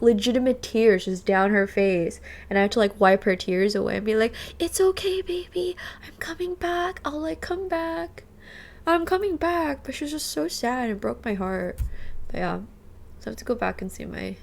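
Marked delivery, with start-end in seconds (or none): 4.6-9.8 put-on higher, gentle baby-talk comfort; soothing the dog. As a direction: coo to a crying pet that you'll return. Comforting crying dog while leaving.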